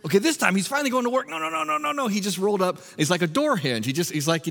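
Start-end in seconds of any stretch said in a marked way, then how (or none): 0.0-2.8 much higher, quick hopeful then let-down cries; excited onlooker. Retold or imitated. Watcher of the sleeper, excited then disappointed. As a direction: cheer him on, then groan as he rolls back over. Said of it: "you're watching him and you're like".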